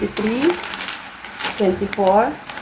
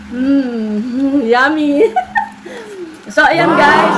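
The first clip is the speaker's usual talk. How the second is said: higher, louder, laughing after the taste; delighted laugh; 5 semitones higher, 3 dB louder, much slower than usual. Real, not acted. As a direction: savour it, then laugh out loud. Tasting the cake, delighted laugh.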